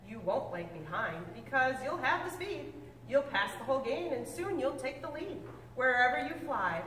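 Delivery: usual speaking voice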